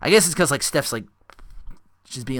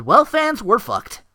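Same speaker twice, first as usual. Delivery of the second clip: quoted commentator; 8 semitones higher, 5 dB louder, slower than usual. Retold or imitated. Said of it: "To quote Dana Winwood".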